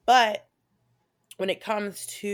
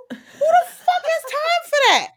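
laughing punchline; 10 dB louder, slower than usual. Real, not acted. Punchline, laughing at own joke.

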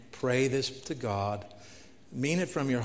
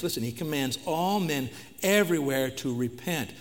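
ordinary talk

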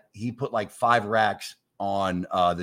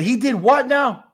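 much higher and louder, hammed-up outrage; outraged disbelief; 14 semitones higher, 7 dB louder than usual. [name] yells at Frank over the cheating excuse, outraged. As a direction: blow up in mock fury.

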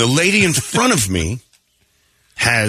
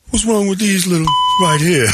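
mimicking the fan; 11 semitones higher than usual. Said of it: "And then he goes".

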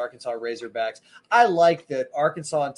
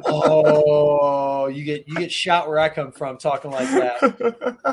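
laughing; 2 semitones higher, 7 dB louder, much slower than usual. Real, not acted. Laughing joke.

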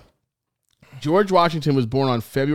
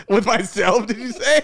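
higher, laughing question; rozbawienie; 8 semitones higher than usual. Real, not acted. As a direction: crack up while asking it. Cracks up on mother's call.

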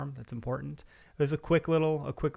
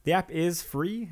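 ordinary talk, nothing marked

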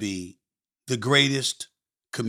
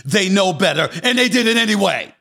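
loud, raised voice building to the end; heated emphasis; 5 semitones higher, 5 dB louder than usual. Real, not acted. Host hammering home that an offense was intentional, heated and rising. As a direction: build from emphatic to an indignant shout. Heated climax of his argument.

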